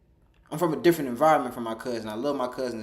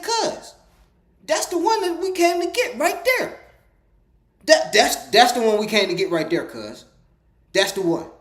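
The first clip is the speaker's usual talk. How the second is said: much higher, louder excited call; mock-urgent excited yelling; excited shout; 10 semitones higher, 7 dB louder than usual. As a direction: point it out, thrilled. Excited spotting the item.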